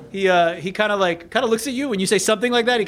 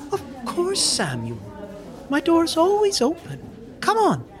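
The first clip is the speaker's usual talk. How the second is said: put-on high, swooping little-old-lady voice; welcoming old nun; 11 semitones higher, 3 dB quieter than usual. Sister Mary invites Sam to talk in private, welcoming. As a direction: welcome them in a high, lilting voice. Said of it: "And she says".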